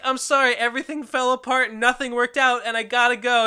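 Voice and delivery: imitating a sobbing pony saying goodbye; mock tearful apology. Voicing crying pony farewell.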